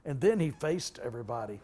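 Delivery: ordinary talk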